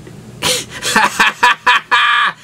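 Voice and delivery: real laughing fit; big laugh. Real, not acted. Cracking up at red enchiladas.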